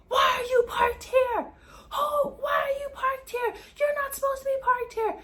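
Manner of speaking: imitating the angry woman scolding about parking. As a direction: mimic her shrill nagging.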